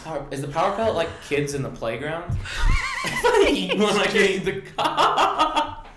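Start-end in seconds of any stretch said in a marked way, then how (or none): 2.3-5.9 words broken by laughter, slow; laughing. Real, not acted. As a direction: laugh through the line. Laughing mid-joke.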